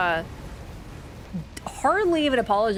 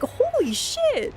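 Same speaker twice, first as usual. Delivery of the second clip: much higher and slow, stretched out holy; shocked exclamation; much slower than usual. Retold or imitated. Reacting to being shouldered on the bridge, stunned and slow. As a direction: drag it out in stunned disbelief. Shoved by British guys on bridge.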